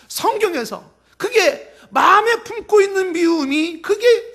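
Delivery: high, urgent sermon voice; impassioned preaching. Real, not acted. Preacher warns congregation hatred is already sin, impassioned preaching. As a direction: press the warning on the congregation.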